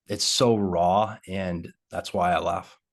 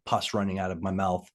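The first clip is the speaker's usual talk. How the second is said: normal talking voice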